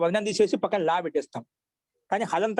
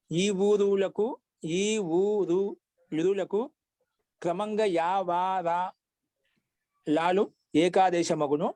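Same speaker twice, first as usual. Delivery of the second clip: very drawn-out vowels, deliberate recital; slow rule recitation; much slower than usual. Shown as a demonstration. Teacher restarting the grammar rule for students, slow recitation. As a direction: stretch each sound as you dictate.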